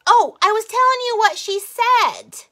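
sudden recollection. Real, not acted. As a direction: blurt it out as the lost thread comes back. Suddenly remembers the lost thread.

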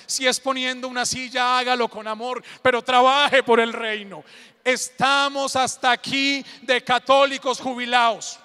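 loud, rousing sermon exhortation; full-force preaching. Real, not acted. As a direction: preach it out loud, urging the crowd to move.

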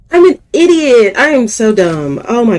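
loud and high, exasperated at herself; frustrated outburst. Real, not acted. Frustrated at failing the jump.

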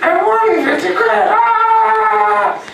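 high, laughing shout of a win; excited scream. Retold or imitated. Winning fifty quid at the shop, shrieking. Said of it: "It's like the inward scream".

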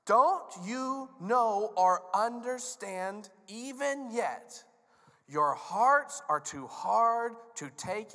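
slow, loud, drawn-out rebuke; stern, high-pitched booming frustration; exasperated Jesus. Acted. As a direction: hammer each word at slow-witted students. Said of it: "So he said".

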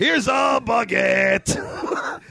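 put-on slow, high shouted French accent; bad French accent. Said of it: "Nobody in this room can do a French accent".

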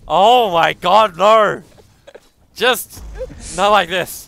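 loud, high cries of mock despair; horrified shouting. Real, not acted. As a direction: wail in protest, very loud and drawn out. Horrified at co-caster's accent.

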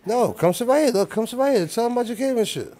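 high, loud, fast coaxing; excited urging. Real, not acted. Host urging his shy friend to sit, loud and excited. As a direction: pull her over, all hyped up. Insisting she come sit down.

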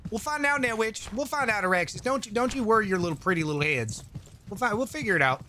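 folksy Texan, talking down to chat; Texan accent. Pretend. Streamer answering chat in a light Texan accent. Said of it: "Why we went from Texan to that?".

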